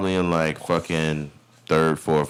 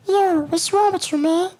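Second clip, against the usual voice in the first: high calming voice. Retold or imitated. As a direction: squeak it out like a soothing inner friend. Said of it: "this is my voice that I use to calm myself down".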